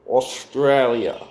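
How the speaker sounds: slow, drawn-out Australian say of Australia; Australian accent. Retold or imitated. Mimicking a viewer from Australia in an Australian accent. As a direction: imitate how an Australian says Australia. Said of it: "I like how Australians say the word Australia".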